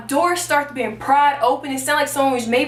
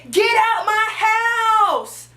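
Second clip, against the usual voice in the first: high, drawn-out re-enacted shout; yelling; 7 semitones higher, much slower than usual. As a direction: yell it slowly, stretching every word to chase off an intruder. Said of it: "So I'm yelling with a knife".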